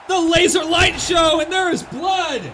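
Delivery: shouted, high play-by-play call; excited shout. Real, not acted. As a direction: yell the big moment. Big move in wrestling commentary.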